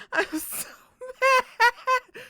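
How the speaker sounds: high, slow, laughing through it; laughing mock mad. Real, not acted. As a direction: pretend to be mad while giggling.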